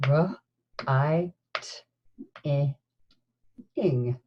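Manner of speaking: R, I, T, I, ing, each sound split apart; sounding out words. Shown as a demonstration. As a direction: segment writing into R, I, T, I, ing. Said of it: "say the sounds in writing".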